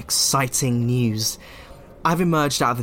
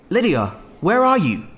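calling out; 6 semitones higher, 4 dB louder than usual. Acted. Calling through the door.

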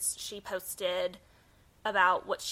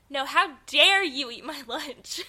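slow, higher and louder mock indignation; mock outrage; 5 semitones higher, 6 dB louder than usual. Pretending to scold a lunch thief, mock outraged. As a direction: drag out "how dare you" in hammed-up offense. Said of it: "and I'm like".

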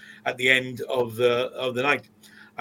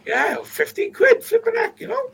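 incredulous exclamation; 6 dB louder than usual. Real, not acted. Laughing at a cheap price with a high incredulous squeal.

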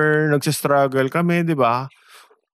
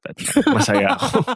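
laughing; 12 semitones higher than usual. Real, not acted. Jokes about money and happiness.

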